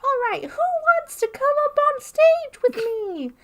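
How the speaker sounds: high, loud, squeaky puppet voice; Elmo voice. Retold or imitated. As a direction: do Elmo calling to the crowd. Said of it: "and he's like".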